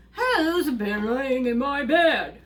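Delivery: loud, slow, indignant big bear voice; Papa Bear. Acted. Father bear discovering his bed disturbed, loud indignant demand. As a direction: boom it out slowly as a bear. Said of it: "Papa Bear goes".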